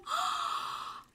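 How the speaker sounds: high, sharp excited gasp. Real, not acted. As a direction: gasp in delight. Excited gasp at worm buckets.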